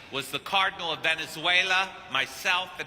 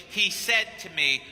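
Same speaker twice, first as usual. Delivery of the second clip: ordinary delivery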